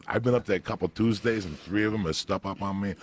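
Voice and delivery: ordinary talk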